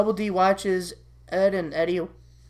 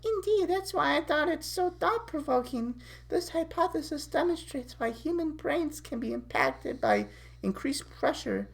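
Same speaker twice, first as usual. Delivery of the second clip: higher, softer, precise intellectual voice; brainy Candace; 9 semitones higher, 7 dB quieter than usual. Acted. Candace discussing theories with Double D, high soft bookish voice. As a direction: play a studious girl on a date.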